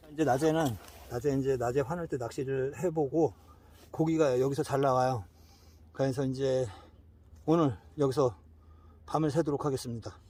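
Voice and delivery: presenter voice. Acted. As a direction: announce the plan to viewers. Addresses camera as presenter.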